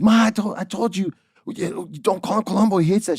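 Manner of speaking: high-pitched, flustered reproach; exasperated scolding. Retold or imitated. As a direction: imitate an exasperated son telling off his mother. Dad scolds grandma after her blunder.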